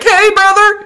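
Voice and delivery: high, loud buddy-buddy greeting; mock friendly bro. Befriending the hockey neighbour in a hammed-up bro voice. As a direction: sweet-talk him like an old pal.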